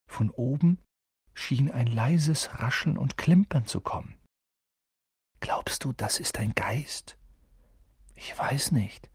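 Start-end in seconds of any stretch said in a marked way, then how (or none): 5.4-9.1 whispering. Acted. Whispering in dark passage.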